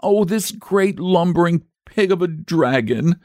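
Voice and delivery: put-on high, aggrieved little boy; acted boyish sobbing; mock sobbing. A narrator's reading. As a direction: complain tearfully like a tired child. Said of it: "sobbed the boy".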